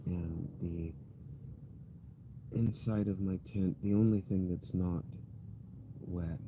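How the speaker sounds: barely audible, drained after days of rain; dejected murmur. Real, not acted. Rain-soaked tent, wet sleeping bag.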